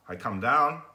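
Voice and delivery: ordinary talk, nothing marked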